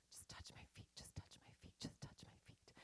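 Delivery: barely voiced, pleading whisper; desperate whisper. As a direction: beg in a hushed whisper, over and over. Desperate whispered prayer to husband.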